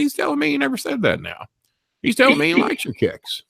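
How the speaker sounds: high, mocking Tim's squeal; lightly mocked high voice. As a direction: mimic the scared guy. [name] relaying Tim in squeal.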